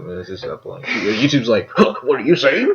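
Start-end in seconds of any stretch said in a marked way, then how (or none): 1.7-2.7 puzzled corporate exec voice; clueless corporate suit; business corporate man. Retold or imitated. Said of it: "Like a business corporate man".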